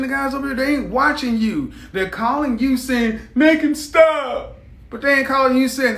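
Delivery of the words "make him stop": drawn-out, high, loud mock complaint; mock pleading women. Women calling on men to step in, mock pleading. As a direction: imitate a whiny plea, stretching it slowly.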